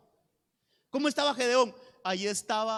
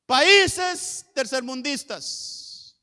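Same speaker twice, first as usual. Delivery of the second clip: loud, high, slow pronouncement; drawn-out public branding; proclaimed derogatory label; 4 semitones higher, 9 dB louder, much slower than usual. Acted. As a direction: announce the label like a verdict. Voices negative labels on country.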